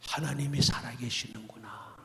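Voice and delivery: breathless whispered wonder; awed whisper. Retold or imitated. New kids whispering in awe at the stopped rain. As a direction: whisper it in amazement.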